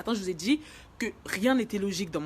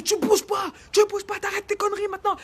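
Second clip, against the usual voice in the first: high, loud scolding yell; imitating fed-up fireman; 13 semitones higher, 7 dB louder than usual. Fireman restraining her on the stretcher, acted exasperated shout. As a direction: shout an order to hold still. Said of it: "il commence à en avoir ras le cul".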